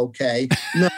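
normal talking voice

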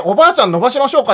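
put-on high, loud call to an old lady; retold helpful offer. Hiker offering granny a leg stretch, acted out high and loud.

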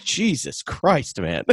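mock-stunned father; dad's shocked reply. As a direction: stretch it out like a stunned dad. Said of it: "And he went".

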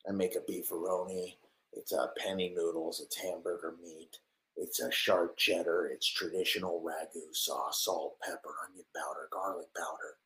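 close-mic murmur. Acted. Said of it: "that's why Tim Dillon talks like that".

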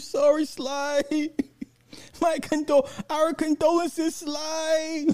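very high, slow falsetto sympathy; mock fan condolences. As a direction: squeak it like a pitying fan. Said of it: "sending me super chats and sad face emojis".

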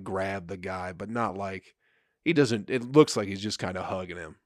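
ordinary delivery

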